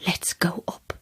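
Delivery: soft, unvoiced, eerie whisper; ghostly, conspiratorial whisper; hushed whisper. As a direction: whisper the idea like a secret. Medium leading her upstairs.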